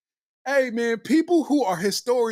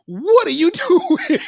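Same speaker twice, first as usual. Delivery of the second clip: laughing disbelief; 6 semitones higher, 5 dB louder, slower than usual. Real, not acted. Incredulous laughing outburst.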